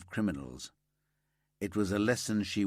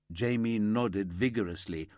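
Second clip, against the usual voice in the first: ordinary delivery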